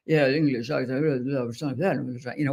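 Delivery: light English accent imitation, lips barely moving; hint of English accent through still lips; imitating William Buckley's English accent. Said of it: "he had that stereotypical".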